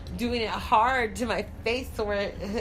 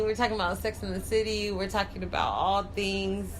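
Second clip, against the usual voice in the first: usual speaking voice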